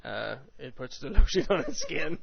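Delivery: creepy quote broken by laughter; lightly voiced Buffalo Bill line; serial killer voice. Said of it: "serial killer voice".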